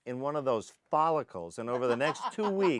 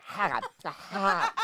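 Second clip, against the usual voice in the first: mock witness laugh; 7 semitones higher than usual. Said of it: "They were like".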